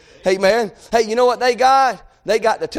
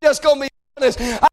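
crying-out prayer; 3 semitones higher than usual. Shown as a demonstration. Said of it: "we need to say".

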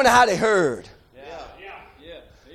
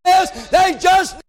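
high, ringing revival delivery; impassioned preaching; 6 semitones higher than usual. Real, not acted. Preacher retelling blind men crying out for mercy, impassioned preaching. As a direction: build it phrase by phrase to a cry.

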